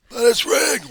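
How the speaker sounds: high, shouted mock complaint; mock protest. Election denier insisting to a doubter, high mock shouting. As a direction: yell it like a stubborn objector. Acted quarrel over rigged vote.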